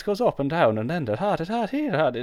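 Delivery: bouncy up-and-down lilt on "da, da, da"; sing-song lilt. Shown as a demonstration. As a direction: pitch swooping up and down. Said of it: "hear Irish accents as a lot more sing-songy".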